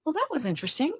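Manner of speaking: high, lightly intrigued huh, then mild interest; curious huh. Shown as a demonstration. Therapist modelling curiosity toward an inner reaction, pitched high. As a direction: greet the reaction like a surprising discovery. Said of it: "with curiosity".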